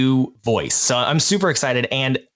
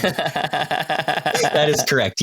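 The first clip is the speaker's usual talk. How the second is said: laughing; 3 semitones higher, much slower than usual. Real, not acted. Laughing at the joke.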